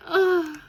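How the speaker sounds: high, loud delighted ah; delighted squeal. Real, not acted. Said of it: "Is that a meditating sloth?".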